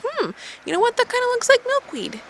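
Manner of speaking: high, put-on musing voice. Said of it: "and I was like".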